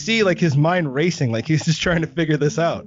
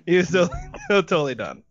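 real laughter breaking into the words; laughing; 7 semitones higher than usual. Real, not acted. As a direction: tell it while cracking up. Cracking up at own story.

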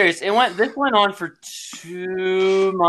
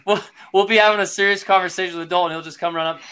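ordinary talk

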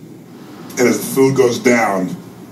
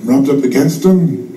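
usual speaking voice

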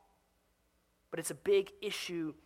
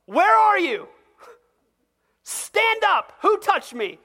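loud, much higher call to the crowd; preacher acting Jesus calling out to the crowd, loud; 13 semitones higher, 13 dB louder, slower than usual. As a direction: shout it out over a crowd.

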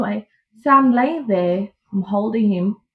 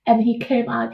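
ordinary talk, nothing marked

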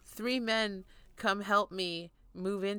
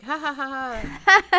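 light mock "ha ha" laugh, a bit louder; mock laughter; 5 semitones higher, 12 dB louder, faster than usual. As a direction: laugh along like a friendly crowd. Imitating the line laughing along.

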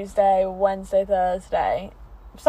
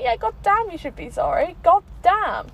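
mock indignation; 8 semitones higher, 4 dB louder than usual. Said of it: "And I was like".

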